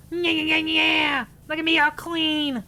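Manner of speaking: high goblin cackle-cheer. Acted. Goblin streamer cheering his clean game area, high. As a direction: gloat like a tidy little goblin. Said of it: "For the voice, it's goblin time".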